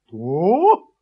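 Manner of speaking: loud, astonished rising question; surprised. A narrator's reading. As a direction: blurt it out in loud disbelief. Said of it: "удивилась собака". (громко, с удивлением)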